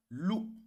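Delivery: "Lou" with the voice climbing high; high, rising "Lou". Shown as a demonstration. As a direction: make "Lou" rise sharply. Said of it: "la voix monte".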